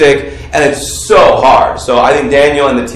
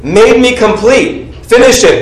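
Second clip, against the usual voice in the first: preacher calling for a response; urging call-out; 7 semitones higher than usual. Real, not acted. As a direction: coax the room to respond. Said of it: "shout it out".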